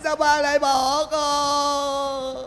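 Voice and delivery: slow, drawn-out mock lament; staged wail. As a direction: stretch every word into a staged sob. Hired mourner wailing again.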